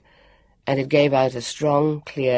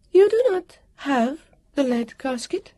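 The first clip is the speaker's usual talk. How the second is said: high, halting, incredulous question; quite surprised; 11 semitones higher, 3 dB louder than usual. A narrator's reading. Guardian learning they lack the lead, quite surprised. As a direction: lift the voice in measured surprise. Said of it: "She sounded quite surprised".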